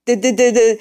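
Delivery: high, dumb-sounding duh sounds; mocking duh. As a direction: play the troll as an idiot.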